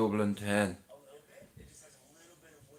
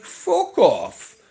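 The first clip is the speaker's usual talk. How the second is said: much higher, louder joking snap; mock retort; 8 dB louder than usual. Answering his daughter's swearing warning, a loud joking retort. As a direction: brush her off in fun, loud and high. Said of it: "and I'm like".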